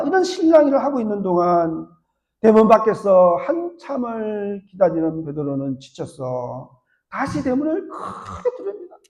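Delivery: usual speaking voice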